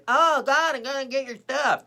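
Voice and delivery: high, loud put-on burglar cry. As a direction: holler it like a hammed-up window intruder. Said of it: "like [name] crawling in your window".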